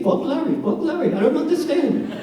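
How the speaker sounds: giddy high-pitched mock swearing; excited mimicry.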